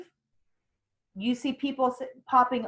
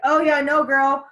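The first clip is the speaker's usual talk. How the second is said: loud, lightly acted girlfriend agreement; supportive commenter; 4 semitones higher, 8 dB louder than usual. Coach voicing viewers' replies, lightly hammed-up sympathy. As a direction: chime in emphatically, a touch higher.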